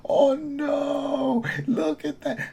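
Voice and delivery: very high falsetto cry of pretend dismay; mock alarm. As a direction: squeal it in put-on panic. Acts out watching the meter climb.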